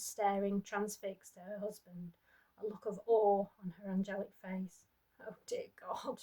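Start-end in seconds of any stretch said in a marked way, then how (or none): 5.2-6.2 soft, quiet despairing mutter; the dying wife mutters to God; muttered prayer. Acted. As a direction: breathe it out in despair. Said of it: "she muttered".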